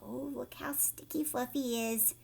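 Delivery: plain reading voice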